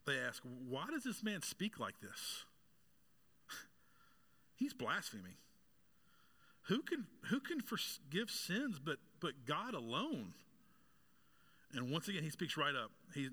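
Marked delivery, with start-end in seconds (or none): 0.4-10.3 slow, drawn-out incredulous questioning, pitch swinging up; mock the leaders' offended disbelief, drawing out the words; confused indignation. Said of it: "confusion, even anger from them".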